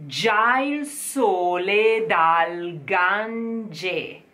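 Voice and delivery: Già il sole dal Gange drawn out very slowly; very slow diction model. Shown as a demonstration. Coach modelling the phrase again, very slow. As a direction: lay out each syllable for copying. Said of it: "You try".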